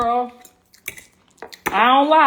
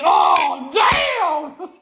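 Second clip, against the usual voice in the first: shocked shout; 5 semitones higher than usual. Retold or imitated. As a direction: recoil from the stink. Reacting to the smell.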